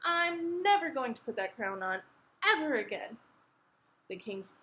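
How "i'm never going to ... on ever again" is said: high, defiant vow; raised-pitch, understated shouting; mild defiant shout. A narrator's reading. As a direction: refuse like a stubborn princess. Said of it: "Isabella shouted back".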